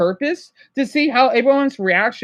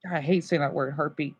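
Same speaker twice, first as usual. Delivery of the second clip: much lower, softer murmur; muttered regret; 10 semitones lower, 10 dB quieter than usual. Real, not acted. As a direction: mutter it, ashamed. Regretful murmur after sorry.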